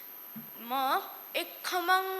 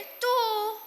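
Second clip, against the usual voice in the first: high little answer; small mouse voice; 6 semitones higher than usual. Acted. Mice answer. (उंदराचा बारीक आवाज)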